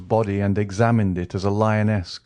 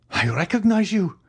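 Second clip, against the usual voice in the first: much higher, strained repeat; heightened recognition; 12 semitones higher than usual. A narrator's reading. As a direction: push it up as the truth hits. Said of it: "exclaimed the king's attorney".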